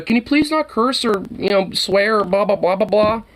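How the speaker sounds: high whiny mocking voice of mom. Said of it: "my mom, is, uh".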